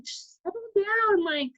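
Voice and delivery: high, playful mock reassurance; mock soothing boyfriend. Boyfriend mimicked calming her down, high-pitched.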